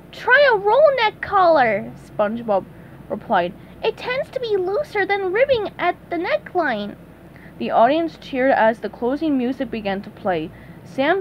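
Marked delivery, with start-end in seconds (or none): 0.1-1.9 SpongeBob voice. Acted. SpongeBob answers. 3.8-6.9 SpongeBob voice. Acted. SpongeBob answers.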